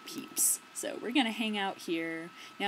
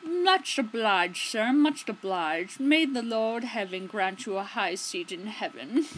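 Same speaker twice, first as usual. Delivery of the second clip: slow, humble beggar, a little louder; fawning old beggar voice; 4 dB louder, slower than usual. Acted. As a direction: grovel gratefully, drawn out.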